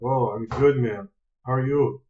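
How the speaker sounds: ordinary delivery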